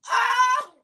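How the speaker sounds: choked sob bursting out; tearful cry out. Real, not acted. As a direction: cry out, overcome. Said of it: "I'm trying not to cry".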